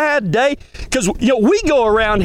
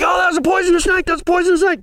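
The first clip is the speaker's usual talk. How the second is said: fast, higher-pitched mock panic; freaking out; 6 semitones higher, faster than usual. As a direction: blurt it out like frightened bystanders. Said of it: "Everybody's freaking out".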